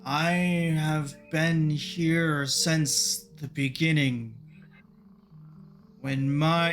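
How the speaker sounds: slow, droning ancient tree. Acted. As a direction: drone slowly like an old tree. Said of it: "in that droning voice for a while".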